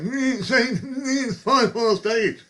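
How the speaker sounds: high, slow drawled calling of the time; drunken time call. Retold or imitated. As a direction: sing out the hour like a tipsy barman.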